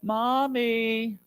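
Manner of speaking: soft, offhand little "Mommy"; a child calling idly, no urgency; casual child call. Retold or imitated. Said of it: "I'm not talking about saying".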